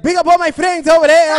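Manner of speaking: mock a DJ yelling to the crowd; mock DJ shout-out. Host mocking a DJ hyping the crowd, loud and high.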